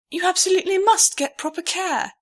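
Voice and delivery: high, loud proper insistence; a prim older mare insisting; the mare insists she see a doctor; insistent mare. A narrator's reading.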